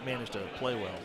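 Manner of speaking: golf commentator. Acted. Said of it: "Don't use the golf commentator voice".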